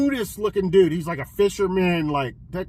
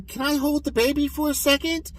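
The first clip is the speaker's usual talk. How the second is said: higher, put-on asking voice; imitated eager pleading; 7 semitones higher, 4 dB quieter than usual. Passengers asking to hold the newborn, imitated pleading. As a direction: play someone asking sweetly for a turn.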